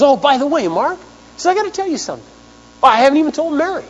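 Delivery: imitating Gary's giddy announcement; excited news. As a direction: burst out with big news.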